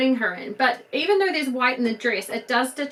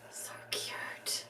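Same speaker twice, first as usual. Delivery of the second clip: tiny unvoiced whisper; awed whisper; 17 dB quieter than usual. Real, not acted. Collector gazing at her finished doll, whispering. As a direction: breathe it out in awe. Doll head just put on.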